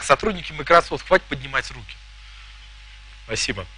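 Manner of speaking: ordinary talk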